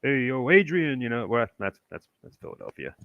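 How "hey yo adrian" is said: put-on loud, high New York street accent; mock New York street accent. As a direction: bark it out like a Philly-New York tough guy. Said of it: "so we have New Yorkers saying".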